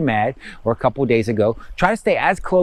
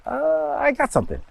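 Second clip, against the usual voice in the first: sudden much higher "uh" of surprise; surprised pickup; 13 semitones higher, 3 dB quieter than usual. Pretend. Guiding a listener as a reading suddenly arrives, surprised and higher.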